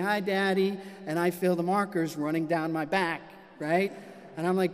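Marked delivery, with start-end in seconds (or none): none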